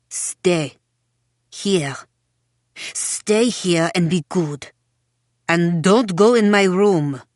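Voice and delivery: slow stern commands; stern order. A narrator's reading. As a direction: lay down the law, word by word. Mother orders daughter sternly.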